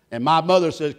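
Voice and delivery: ordinary talk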